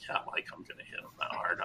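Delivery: quick, whispered thought; gleeful inner voice kept to a whisper. Retold or imitated. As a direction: whisper it to yourself. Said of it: "thinking in my head".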